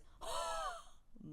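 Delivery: put-on high sharp gasp of a child; mock child gasp. Mother voicing her kid's shocked gasp. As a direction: gasp like a shocked little kid. Kid gasps in retold story.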